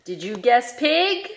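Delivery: normal talking voice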